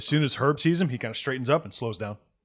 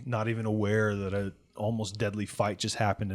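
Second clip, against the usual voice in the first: ordinary delivery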